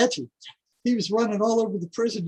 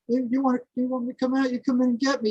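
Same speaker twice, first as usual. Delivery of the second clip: high-pitched goading dare; mock defiant challenge, raised in pitch; retold taunting; 7 semitones higher, faster than usual. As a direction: egg them on, voice pitched up. Inmate taunting guards in retold standoff.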